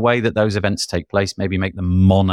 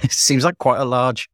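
usual speaking voice

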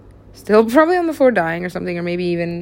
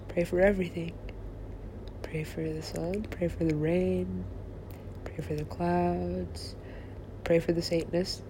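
hushed prayer litany; 2 semitones lower, 12 dB quieter than usual. Real, not acted. Speaker praying for sun, rain and clouds, hushed. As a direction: murmur each line softly, with pauses between.

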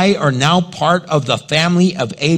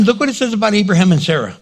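usual speaking voice

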